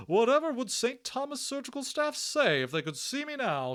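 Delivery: high, loud, laughing matron voice; gurgling Matron. Acted. Matron laughing at her surgical staff, high gurgling voice. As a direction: bubble it out through laughter. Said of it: "she gurgled".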